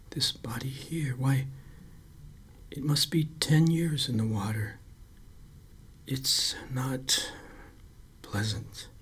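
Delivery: hushed whisper. A narrator's reading. Lifeguard quietly describes the old drowned body, whispered. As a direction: keep it under your breath.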